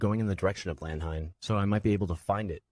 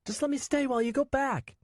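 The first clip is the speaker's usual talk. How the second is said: put-on tearful child. Nico dropped off alone, high tearful child. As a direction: hold back tears as a scared boy. Boy bargaining.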